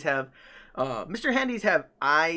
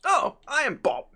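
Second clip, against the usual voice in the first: put-on high, cheery British accent; British voice swooping high; British robot butler; 9 semitones higher than usual. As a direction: introduce yourself as a pitched-up British robot. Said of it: "have a British accent most of the time".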